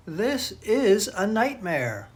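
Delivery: slow, loud, high exclamation; dramatic dismay. A narrator's reading. Tangled boy cannot run away, slow loud dramatic dismay. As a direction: draw out each word in storybook alarm. Boys tangled, unable to flee.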